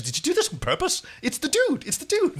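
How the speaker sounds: high, excited disbelief. Retold or imitated. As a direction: blurt it out in excited shock. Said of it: "he ran to the producer".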